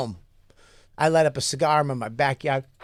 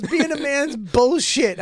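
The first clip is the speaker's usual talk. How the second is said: slow, higher whiny mock of an upset friend; mad and sad friend; 9 semitones higher, slower than usual. Friend complaining the advice failed, slow higher whine. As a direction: drag it out like a sulking buddy. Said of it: "He was so mad and sad".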